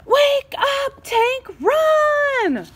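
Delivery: high panicked call; frantic yell. Acted. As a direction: shout to wake a sleeper. Highlighter yelling at hamster.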